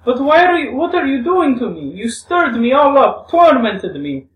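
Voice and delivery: high, loud, anguished reproach; pleading and hurt, pitched very high; Grushenka, distressed. Acted.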